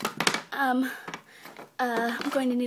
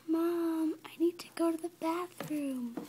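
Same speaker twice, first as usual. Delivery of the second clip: soft, slightly raised little voice; small, quiet plea; pleading child; 4 semitones higher, 9 dB quieter than usual. Acted. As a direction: ask mom meekly in a hush.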